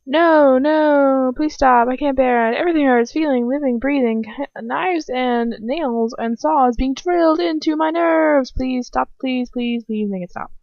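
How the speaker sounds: acted cry of agony, fading at the end; agonised pleading. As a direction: beg desperately for the pain to stop. Tortured by a curse, begging.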